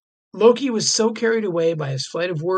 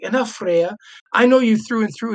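louder, higher mock scolding; Loki scolding Freya; 5 semitones higher, 5 dB louder than usual. Loki turns on Freya, hammed-up retort. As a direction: snap at her like a spiteful trickster. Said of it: "Loki turns to her and is like".

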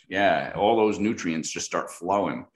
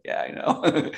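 laughing smugly; 9 semitones higher, slower than usual. Retold or imitated. Smug reply, laughing.

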